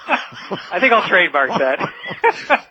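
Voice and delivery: laughing through the words, slow; chuckling as he gets the line out. Real, not acted. Guest amused by the host's slogan, laughing while he speaks.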